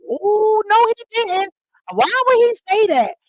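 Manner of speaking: high, loud mock-shocked schoolkids; shocked kids. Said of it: "you hear the kids".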